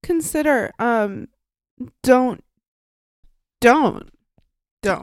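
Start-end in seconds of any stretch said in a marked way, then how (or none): 2.0-5.0 don't, don't, don't, each louder and pleading; emphatic repeated don't. Real, not acted. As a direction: stress every don't hard.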